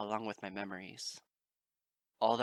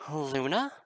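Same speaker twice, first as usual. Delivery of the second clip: high, loud sudden question; startled exclaim; 5 semitones higher, 8 dB louder than usual. A narrator's reading. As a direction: blurt the name in surprise. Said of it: "Twilight exclaimed".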